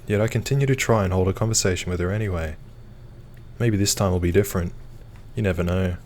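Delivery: normal talking voice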